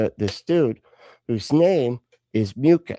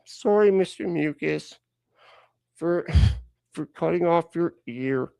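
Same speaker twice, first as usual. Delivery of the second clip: put-on high, halting childish sorry; sulky kid made to apologise; grudging apology; 6 semitones higher than usual. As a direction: drag out a forced apology with long pauses. Forced childish apology in scene.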